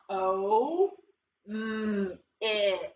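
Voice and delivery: "O, M, it" as separate held sounds; sounding it out. Shown as a demonstration. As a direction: stretch O, hum the M, finish on it. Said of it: "Sound him out".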